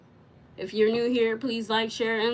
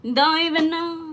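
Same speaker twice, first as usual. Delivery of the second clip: higher, louder exclamation; exasperated outburst; 7 semitones higher, 8 dB louder than usual. Real, not acted. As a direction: throw it out in exasperation. Repeated, loud and high.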